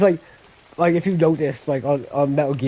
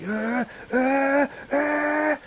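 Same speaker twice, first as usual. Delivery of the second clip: imitated yells; 12 semitones higher, 3 dB quieter, much slower than usual. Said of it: "But he did it verbally".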